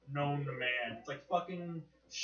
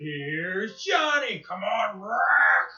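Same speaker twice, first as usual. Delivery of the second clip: mock announcer; 4 semitones higher, 8 dB louder, much slower than usual. Quoting talk show intro.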